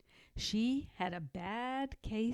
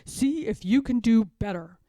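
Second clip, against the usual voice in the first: loud, quick, huffy retort; 4 semitones higher, 9 dB louder, faster than usual. Acted. George storms off from Martha, loud and huffy. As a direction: snap it out fast and louder. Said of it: "he went off in a huff".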